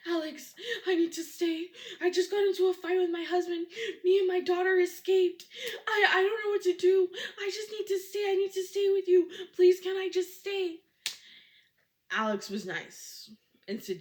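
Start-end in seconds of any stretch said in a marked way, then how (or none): 0.1-10.8 panicked pleading. Retold or imitated. Beaten woman begging at 3 AM.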